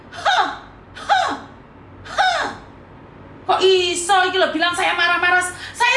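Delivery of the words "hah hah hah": high, separate "hah" bursts; forced laugh. Shown as a demonstration. Streamer proving to critics she is smiling, forced high ha-ha. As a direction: punch out mock laughs one by one, much higher. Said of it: "Saya itu lagi tersenyum".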